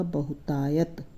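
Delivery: बहुतायत slowly as one whole word; बहुतायत, drawn out, no break. Shown as a demonstration. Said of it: "इकट्ठा पढ़ेंगे तो ये बनेगा". (बहुतायत को एक साथ धीरे से बोलें)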